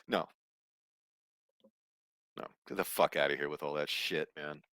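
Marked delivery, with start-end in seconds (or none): none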